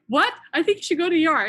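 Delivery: retold alarmed outburst; freaking out. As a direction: blurt it out as a worried friend panicking. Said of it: "She started freaking out".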